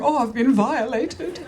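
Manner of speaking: mock swooning prim lady, slow; hammed-up wounded innocence; Victorian virgin. Husband as a prim Victorian virgin, mock swooning. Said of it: "like some sort of Victorian virgin".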